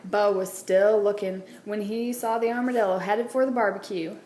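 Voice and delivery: ordinary delivery, nothing marked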